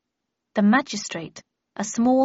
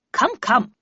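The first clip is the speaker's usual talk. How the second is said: sharp, louder impatient snap; impatient anger; 3 semitones higher, 6 dB louder than usual. A narrator's reading. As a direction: hurry her up, irritated. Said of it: "said Havisham angrily".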